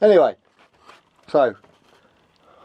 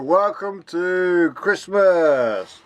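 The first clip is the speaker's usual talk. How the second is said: slow booming Santa welcome; jolly Santa greeting; 4 semitones higher, much slower than usual. Pretend. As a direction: stretch it out like Father Christmas. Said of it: "what am I on about?".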